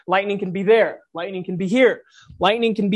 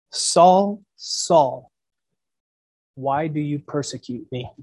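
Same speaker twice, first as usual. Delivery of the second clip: voice of Jesus; 6 semitones lower than usual. Acted. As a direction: call his name slowly, low and hushed. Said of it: "that was the tone, that was the volume".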